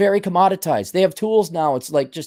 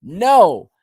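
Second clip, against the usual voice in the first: loud, high exclaimed no; exasperated refusal; 5 semitones higher, 8 dB louder than usual. Real, not acted. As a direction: cut them off with a loud no. Cutting off the excuse.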